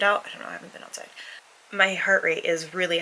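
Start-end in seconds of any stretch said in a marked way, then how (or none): 0.3-1.1 breathy whispered aside; whispering. Real, not acted. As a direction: drop to a whisper. Muttered aside while winded.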